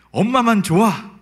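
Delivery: a hint of a sulking little girl; pouty little daughter. Daughter sulking at dad after a scolding, pouty child voice. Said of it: "하고 엄마한테 가서".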